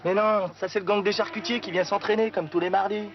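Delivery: loud, high-pitched sarcasm; exasperated, mocking comeback; sarcastic retort. Sarcastic answer to an obvious question.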